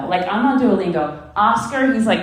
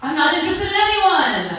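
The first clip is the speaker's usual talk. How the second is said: high mock; 10 semitones higher than usual. Retold blunt reply, high.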